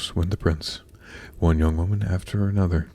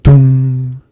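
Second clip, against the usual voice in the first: loud, booming "dong" held on one note; clock chime; 6 semitones higher, 14 dB louder than usual. A narrator's reading. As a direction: imitate a striking clock, ringing out the hum. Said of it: "rang the clock".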